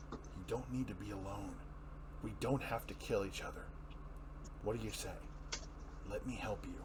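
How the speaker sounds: low, soft, grave Batman plea; steady, hushed appeal to an enemy; Batman pleading. Acted. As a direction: Batman quietly pleading with the Joker. Batman's voice in reading.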